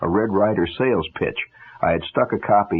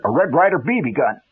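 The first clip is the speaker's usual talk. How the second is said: blurted out; 6 semitones higher than usual. A narrator's reading. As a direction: let it burst out before you can stop it. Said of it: "I heard myself blurt".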